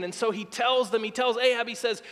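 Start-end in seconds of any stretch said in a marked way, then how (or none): none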